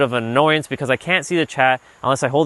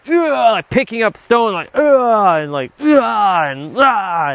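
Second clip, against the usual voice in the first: high, loud frustrated ughs; mock angry grunts; 8 semitones higher, 4 dB louder, slower than usual. Shown as a demonstration. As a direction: act out getting mad. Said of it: "that's what being mad is about".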